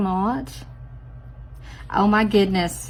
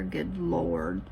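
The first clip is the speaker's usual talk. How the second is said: muttered exclamation; 5 dB quieter than usual. Real, not acted. Reacting to her mistake.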